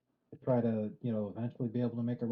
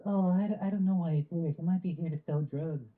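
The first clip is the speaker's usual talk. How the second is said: high-pitched fretful busybody voice; mock worried neighbor, pitched well up; mocking Karen; 6 semitones higher, 3 dB louder than usual. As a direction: imitate a suspicious Karen. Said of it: "Some dumbass Karen".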